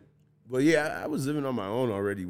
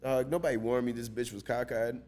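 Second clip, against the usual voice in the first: ordinary talk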